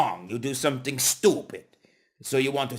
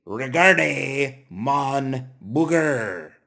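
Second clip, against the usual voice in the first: slow demo; much slower than usual. Shown as a demonstration. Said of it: "Then you would say".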